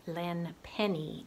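usual speaking voice

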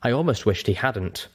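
ordinary delivery

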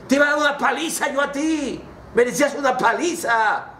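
high, indignant reprimand; acted paternal outrage; scolding father. Father scolds returned son.

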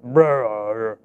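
imitated rumbling stomach growl, low. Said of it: "stomach goes".

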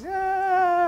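high, long, reluctant "yeah"; doubtful. Acted. Lady turning down the iguana, doubtful.

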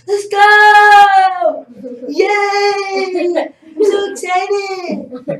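high, drawn-out imitated family cheers; background yelling. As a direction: mimic relatives cheering wildly behind a phone call. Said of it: "in the back of my mom yelling".

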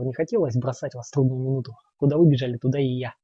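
ordinary delivery, nothing marked

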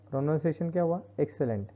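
normal talking voice

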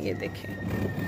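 soft unvoiced whisper. Real, not acted. Owner whispering to viewers while the cat plays. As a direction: whisper so the cat isn't disturbed.

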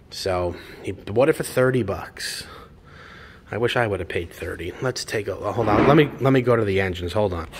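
normal talking voice

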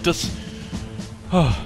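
ordinary delivery

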